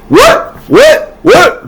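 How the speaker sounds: squeaked way up high, mock indignant; a kid playing shocked innocence; outraged what. Student mocking the teacher, squeaking high in fake shock.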